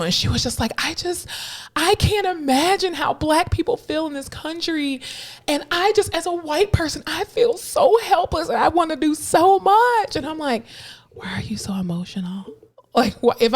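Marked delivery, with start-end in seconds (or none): 0.8-10.2 high-pitched, overwrought colleague imitation; mock emotional. 11.1-12.4 hushed, puzzled aside; baffled near-whisper. Retold or imitated. Speaker baffled by her tearful colleague, hushed.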